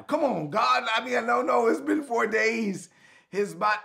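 high, pleading protest; a touch of exasperated Martha. Retold or imitated.

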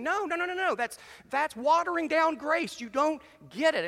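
high, loud, emphatic Paul; hammed-up indignant apostle; indignant protest.

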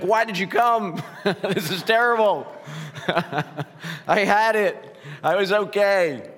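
high, loud, laughing protest; laughing through tears. Real, not acted. Pastor laughing at friends in the pews, loud and high. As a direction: laugh it out, half overwhelmed. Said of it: "I'm getting choked up".

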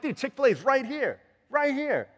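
high, loud insistent protest; exasperated pleading. Acted. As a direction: plead the obvious in mock exasperation. Acted birthday restaurant quarrel.

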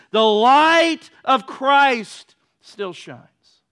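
loud, high fervent proclamation, slowing at the end; preaching at full force. Acted. Preacher proclaiming joy in darkness, loud and fervent. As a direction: build it up and let it ring.

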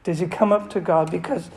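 ordinary delivery

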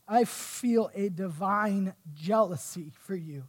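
slow, deliberate scripture reading; slow emphatic rereading. Shown as a demonstration. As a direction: dwell on each word of "I feel a divine jealousy". Rereads the phrase he admires.

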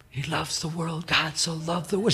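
quietly quoting scripture; lightly. Retold or imitated. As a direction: say it gently, almost under the breath. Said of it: "just lightly".

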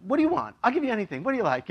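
high excited offering; eager dad. Retold or imitated. Pastor imitates himself gushing over his daughter's card, high and eager. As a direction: gush at the child like a doting dad. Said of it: "I will do".

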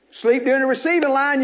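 ordinary delivery

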